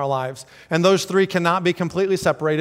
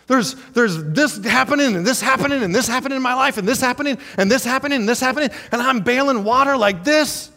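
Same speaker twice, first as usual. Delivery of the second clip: mock frantic complaint; 6 semitones higher than usual. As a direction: pile it on, overwhelmed. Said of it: "How come I'm not resting".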